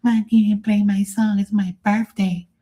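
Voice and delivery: very high, whiny mock tantrum; mock drunk passenger. Drunk birthday guest cursing the DJ for a song, high mocking whine. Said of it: "They'll be like".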